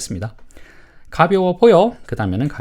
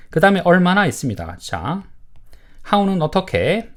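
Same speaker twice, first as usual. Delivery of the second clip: ordinary talk, nothing marked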